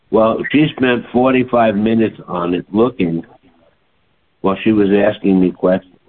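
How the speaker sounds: ordinary delivery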